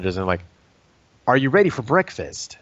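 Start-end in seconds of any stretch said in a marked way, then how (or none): 1.3-2.6 cheesy chipper voice. Pretend. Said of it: "why are you talking like that".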